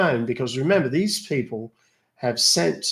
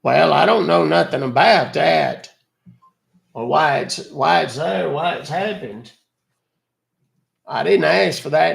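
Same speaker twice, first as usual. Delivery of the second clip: lightly voiced old man, louder and put out; old caller Buster, indignant; 3 semitones higher, 4 dB louder than usual. Caller refusing the renewal, a lightly voiced indignant old man. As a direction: protest the charge like a cranky old customer.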